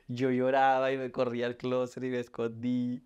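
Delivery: ordinary delivery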